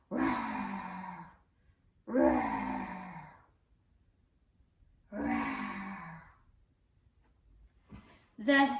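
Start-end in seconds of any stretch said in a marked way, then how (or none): 0.1-6.2 very low, rumbling roar; polar bear growl. Acted. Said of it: "the polar bear growled".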